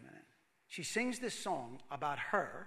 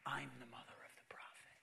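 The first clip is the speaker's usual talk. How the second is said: hushed, breathless whisper of wonder; a stunned inner whisper; awed whisper; 5 semitones lower, 13 dB quieter than usual. Acted. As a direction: whisper it like a secret too big to say. Elizabeth's realization, voiced inwardly.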